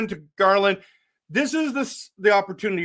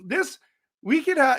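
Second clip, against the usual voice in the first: normal talking voice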